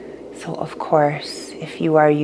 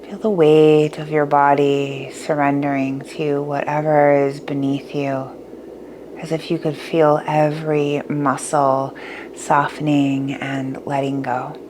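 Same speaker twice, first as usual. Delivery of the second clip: guided meditation; 6 dB quieter than usual. Acted.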